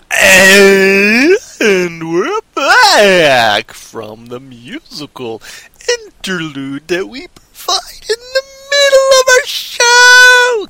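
wildly stretched, swooping high holler; hammed-up show return. Host bringing listeners back from musical interlude, hammed-up wailing holler. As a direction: wail it slowly, climbing to a high shriek.

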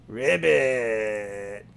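a deep, drawn-out croak; low frog croak. Acted. Vampire frog accepting the bark with a low croak. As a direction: croak it slow and low like a big bullfrog.

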